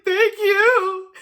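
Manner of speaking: high, loud, voice cracking with tears; choked-up thank you to the kind manager; tearful thanks. Real, not acted. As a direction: thank him through tears.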